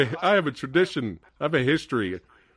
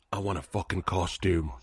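low, quiet character line; a hint of a low character voice; lightly voiced movie villain; 7 semitones lower, 7 dB quieter than usual. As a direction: quote it low and under your breath. Quotes a character, Bullseye.

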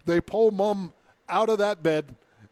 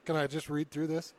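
usual speaking voice

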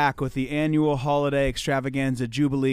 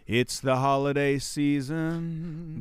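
drawn out, grand; slow announcer; much slower than usual. Acted. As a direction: savor each word.

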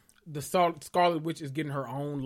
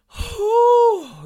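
high, loud exhale of exhaustion; tired whew; 13 dB louder than usual. Real, not acted. To viewers, stuffed after pasta: loud tired whew. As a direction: blow it out like you're worn out. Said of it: "y'all, I'm tired".

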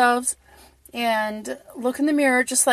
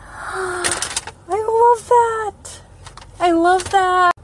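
high gasp then loving cry; delighted gasp; 9 semitones higher, 4 dB louder, much slower than usual. Real, not acted. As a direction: gasp and gush over it. Gasps at bag design.

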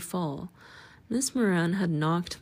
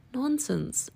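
a touch of impatience, pitched up; a little impatient; 6 semitones higher than usual. A narrator's reading. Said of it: "as I thought a little impatiently".

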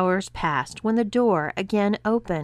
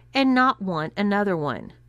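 plain reading voice

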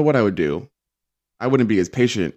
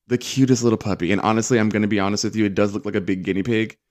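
ordinary delivery

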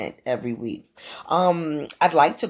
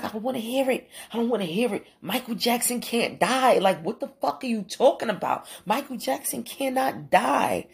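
high, pleading mock sob of a boy refusing the news; imitating crying teen; 9 semitones higher than usual. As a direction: act out the upset boy, lightly.

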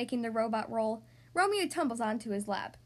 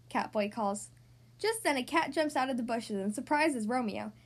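ordinary delivery, nothing marked

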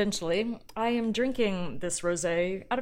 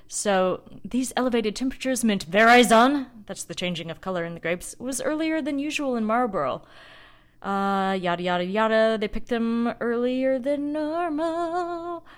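reading report aloud; 4 semitones higher, 6 dB louder than usual. Acted.

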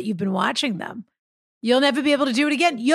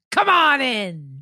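pirate voice; much slower than usual. Pretend. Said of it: "It's just a pirate voice".

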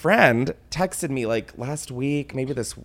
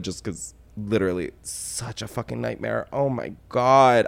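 ordinary talk

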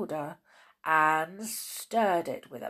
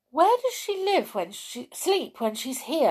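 put-on high, curious girl voice; little girl voice; 10 semitones higher, 4 dB louder than usual. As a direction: Beth asks wide-eyed. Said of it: "asked Beth".

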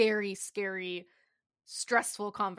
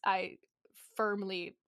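normal talking voice

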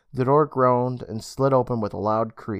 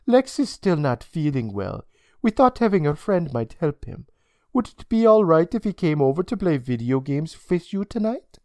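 put-on polite European-accented request; lilting, formal European accent; 5 semitones higher than usual. A narrator's reading. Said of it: "in her heavy European accent".

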